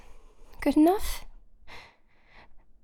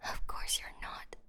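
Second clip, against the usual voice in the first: bitter whisper; 13 dB quieter than usual. Acted. Bitter aside during the search.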